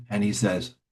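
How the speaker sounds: ordinary delivery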